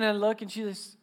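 ordinary delivery

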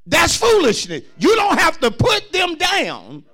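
high, loud, slowed preaching cry; full-force preaching. Acted. Pastor preaching to parents, in full loud cry. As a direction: preach it hard to the church, lifting the voice.

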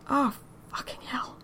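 breathy whispered dismay; a shocked whispered oath; whispered curse. Acted. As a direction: mutter it under the breath, stunned. Daryl's stunned reaction.

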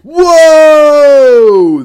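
sudden loud, high whoa of delight; excited shout. Real, not acted. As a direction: burst out with a big whoa. Excited at 420-69 idea.

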